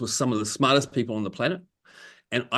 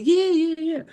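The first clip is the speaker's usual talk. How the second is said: much higher, mock excited yeahs; high imitated enthusiasm; imitating eager agreement. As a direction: parody his keen nodding along. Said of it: "He's like".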